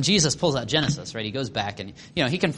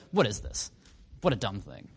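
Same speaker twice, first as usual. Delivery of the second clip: low, soft, scornful muttering; derisive mockery; 7 semitones lower, 8 dB quieter than usual. Pharisees deriding the story they just heard, scornful. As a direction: imitate dismissive grumbling, pitched down. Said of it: "they just make fun of him".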